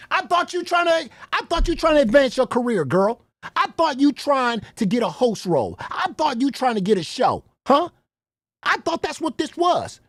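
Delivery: high, loud mocking hustler; mock pressuring. Mocking those who pitched her a host role, high and pushy.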